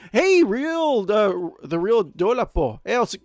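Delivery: greeting in a high, put-on accent, stretching the word real; drawn-out accented real before the name Dolapo; unknown accent on a name. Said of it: "that's how I'm gonna pronounce it with an accent".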